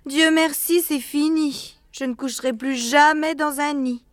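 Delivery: high-pitched, emphatic declaration; fed-up mother. A narrator's reading. Mother bird after a soaking night in the nest, fed up.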